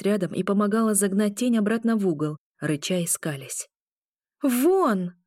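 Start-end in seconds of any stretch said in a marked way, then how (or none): 4.4-5.1 high, contained shout; forceful high-pitched order, short of full yell; shouted command. A narrator's reading. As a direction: cry out to banish it. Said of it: "прокричала я".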